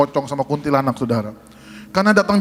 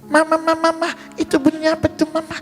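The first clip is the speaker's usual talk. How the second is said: put-on high-pitched curious kid calling mama; cartoon-like high child voice; little child's voice; 11 semitones higher, faster than usual. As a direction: mimic a small child asking mother. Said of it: "reaksinya seperti ini".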